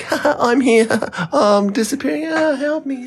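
tiny country voice. Pretend. Tasmania as a tiny country calling for help, in a high squeaky voice. Voicing Tasmania drifting away.

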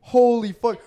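sudden horror at the mistake; shocked realization. Retold or imitated. Learning the stranger is her brother: loud shocked outburst.

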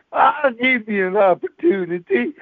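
put-on a sassy old lady warning you off; mock threat.

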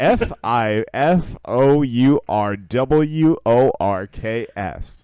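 slow letter-by-letter spelling; spelled out slowly. Shown as a demonstration. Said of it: "if I spell my pick".

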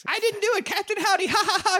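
very high sing-song denial; girl blaming Captain Howdy, very high and giggly; creepy little girl. Retold or imitated. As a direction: giggle it like a possessed child. Girl denying, laughing creepily.

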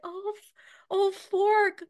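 mom self-censoring. Retold or imitated. Mom swearing in the car, mock prim correction. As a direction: play the mom swallowing a swear. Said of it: "when you were a kid, it's like".

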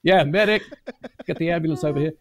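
high-pitched pretend call for help; mock emergency call. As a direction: play along, summoning help in jest. Plays along calling for help.

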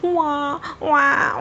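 mock child wailing waah waah; imitated baby wail. A narrator's reading. Narrator mimics the little boy crying in the park, wailing. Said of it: "वाह करके रो रहा था".

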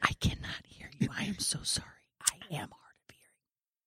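hushed hard-of-hearing teller; whisper. Acted. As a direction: whisper an apology back. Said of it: "We are all whispering".

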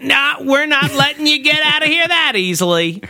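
gums voiced. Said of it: "the gums are still like".

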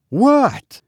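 very high, abrupt question; impatient demand. A narrator's reading. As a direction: cut in, demanding to know. Impatient mistress interrupts the maid.

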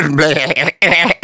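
long broken, stuttering bleat; dying-sheep bleat. Acted. Joke teller voices another sheep to laughing friends, dying bleat. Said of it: "You sound like you're dying".

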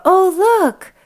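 mock bright mom. Cheering up her son on the way to school. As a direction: brighten up for a grumpy kid. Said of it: "And then I go".

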